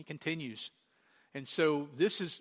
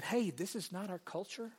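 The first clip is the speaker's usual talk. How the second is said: softer acted inner grumble, rising at the ends; thinking aloud; 3 semitones higher, 6 dB quieter than usual. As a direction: voice their uneasy thought softly. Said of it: "And they're thinking".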